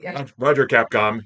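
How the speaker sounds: NASA radio voice. Retold or imitated. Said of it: "What's the nasa accent?".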